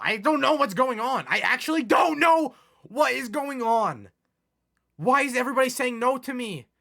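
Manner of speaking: high, loud exasperated shouting; frustrated shout. Real, not acted. Gamer rages after Kyrie rejects him, shouting. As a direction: yell at the game in disbelief.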